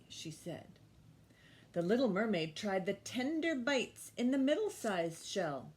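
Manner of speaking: loud, lively read-aloud voice; story reading to children. Acted. Teacher reading The Mermaid to the class, lively storytelling. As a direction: read it big for the little ones. Reads picture book aloud.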